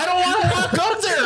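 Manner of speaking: high, fast, laughing complaint. Retold or imitated. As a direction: gripe fast through giggles. Imitating John, laughing.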